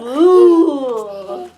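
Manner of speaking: loud laughing moo; big playful moo; cow moo. Pretend. Imitating a cow.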